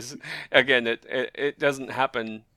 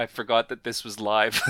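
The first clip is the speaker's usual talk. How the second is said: ordinary talk, nothing marked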